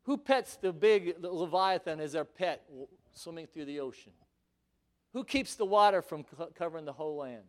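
usual speaking voice

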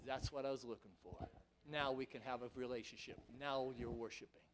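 soft, low voice of God; quiet God. Acted. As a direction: speak gently as God. God speaking softly.

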